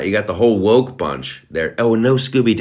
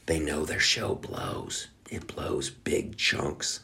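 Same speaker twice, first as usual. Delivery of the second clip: slow, hushed whisper; muttered aside; 4 semitones lower, 12 dB quieter, slower than usual. Real, not acted. Sneering aside about Amazon's show, whispered slowly. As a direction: drop to a sly whisper.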